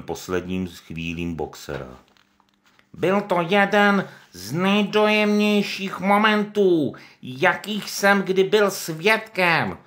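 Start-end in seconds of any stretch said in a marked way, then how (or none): 2.9-9.8 much higher, slow, louder pig voice; Pištík. Acted. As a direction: put on a tearful show of grief. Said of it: "řekl Pištík".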